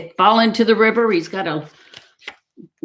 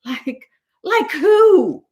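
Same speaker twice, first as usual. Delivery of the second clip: high, slow, nervous question; timid ask; 10 semitones higher, 5 dB louder, much slower than usual. A narrator's reading. Duck asking Raccoon who hides in the cave, high and nervous. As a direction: ask like you dread the answer. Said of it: "asked Duck".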